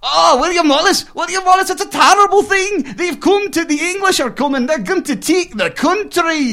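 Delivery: put-on high, loud, panicked Scottish accent. Puppet plays a Scot warning William Wallace, loud high Scottish accent. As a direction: cry the alarm in a hammed-up Scottish accent. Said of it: "we'll have them do a little scene".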